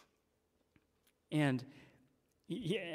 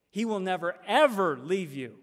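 "ever" hit loud after a held-back "never"; full-force emphasis; 2 semitones higher, 6 dB louder than usual. Shown as a demonstration. Preacher driving home the translated promise to the congregation, full-force emphasis. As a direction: punch "ever" hard and loud. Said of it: "get the full force of it".

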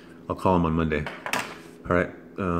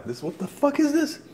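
shrieks high in shocked disgust. Real, not acted. Tasting awful juice on camera, shrieking in disgust. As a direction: recoil with a high, shocked squeal.

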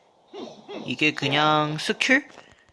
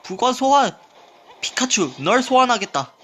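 high, hammed-up summoning cry; anime summoner; 8 semitones higher, 4 dB louder than usual. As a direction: shout it like an anime hero. (애니 주인공처럼 소환하는 외침)

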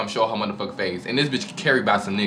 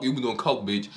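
ordinary delivery

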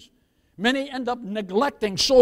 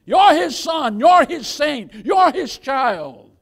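preaching shout; 4 semitones higher, 6 dB louder, slower than usual. Real, not acted. Preacher building acclamation.

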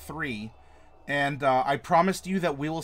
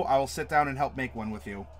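usual speaking voice

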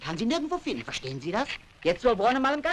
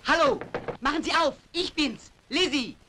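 calling out; 6 semitones higher than usual. Acted. Knocking, calling through door.

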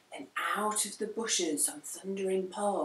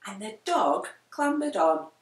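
ordinary delivery, nothing marked

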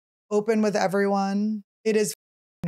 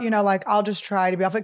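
normal talking voice

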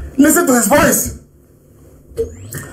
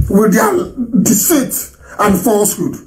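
normal talking voice

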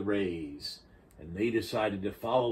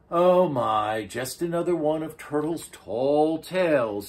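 seahorse; 6 semitones higher, 6 dB louder than usual. A narrator's reading. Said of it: "said the seahorse".

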